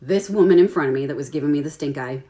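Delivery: ordinary talk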